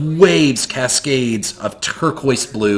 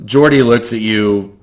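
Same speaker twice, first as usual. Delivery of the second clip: usual speaking voice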